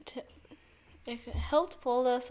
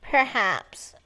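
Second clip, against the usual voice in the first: a lightly put-on nerd voice, much louder; nerdy voice; 8 dB louder than usual. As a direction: play it as an eager nerd. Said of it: "Are we ready for the nerdy voice?".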